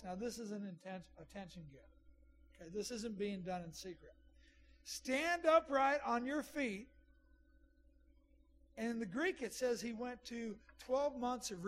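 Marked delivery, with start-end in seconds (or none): 4.8-6.9 loud, higher, drawn-out command. Acted. Preacher quoting Paul to the lame man, loud voice. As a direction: call it out slowly for all to hear. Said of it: "He said with a loud voice".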